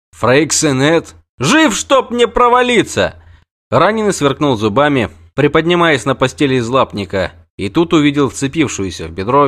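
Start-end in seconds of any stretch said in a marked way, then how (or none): 0.1-3.2 high, loud, drawn out; the wounded knight cheers on seeing the witcher alive; hearty soldier. Acted. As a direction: hail him with rough delight. Freixenet greeting.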